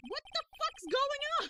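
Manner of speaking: real shock; shocked outburst. Real, not acted. Streamer reacting to Grossberg's refusal, shocked.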